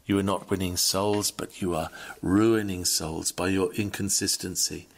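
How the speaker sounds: ordinary talk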